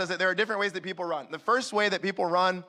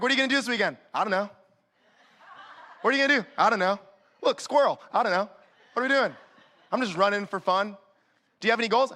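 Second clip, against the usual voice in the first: mock aimless chat; 2 semitones higher, faster than usual. Voicing aimless fun-runner dialogue.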